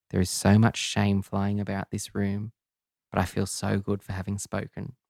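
ordinary delivery, nothing marked

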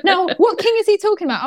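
high, quick laughing question. Real, not acted. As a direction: laugh through the words. Laughing at the joke.

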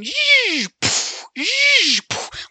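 high, loud swooshing noises; dive-bomb whooshes. Retold or imitated. Mom makes swooshing noises dive bombing her daughter. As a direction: make the bunny zoom in like a toy plane. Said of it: "I start going".

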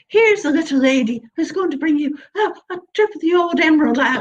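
mock a twee host introducing an Irish act; gushing introducer. Host introducing the Irish storyteller to an audience, gushing parody. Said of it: "I mean, I've been introduced".

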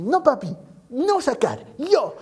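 high-pitched imitation of a stubborn little girl; María Joaquina. Little daughter refusing her father's help, imitated childish protest. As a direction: protest like a headstrong toddler, high and emphatic. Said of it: "la María Joaquina es de temperamento".

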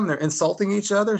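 ordinary talk

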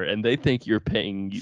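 ordinary delivery

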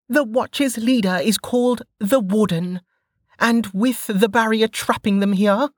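put-on high-pitched brash young woman; Velika's voice. A narrator's reading. Velika scolding the downed sentinel, high and cocky. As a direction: toss it off, cocky and unbothered.

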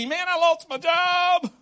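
mock despair. Pastor acting out someone who just lost a job, mock crying. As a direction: play the sorry jobless guy, a touch overdone. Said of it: "It's heartbreaking".

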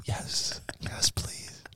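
hushed, voiceless whisper; whispered acceptance. Pretend. Fan accepting imagined marriage proposal, mock-smitten whisper. Acting eager reply to idol's proposal.

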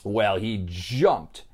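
ordinary delivery, nothing marked

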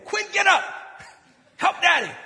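high, loud shout to wake someone; acted irritated parent yelling. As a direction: holler across the house for help. Acted father calling child awake.

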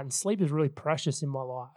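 ordinary delivery

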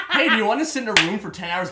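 high, loud, laughing sales pitch; mock cheerful invite. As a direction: pitch it like a silly party invite. Mock invite to trauma session.

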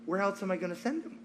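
soft, understated answer; calm divine reply. Retold or imitated. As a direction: answer the outburst quietly, deadpan. Jesus calmly answers the outcry.

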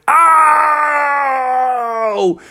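long, high, hammed-up "ow"; shark's howl of pain. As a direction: wail out a drawn-out cartoon ow. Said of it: "And Jaws went".

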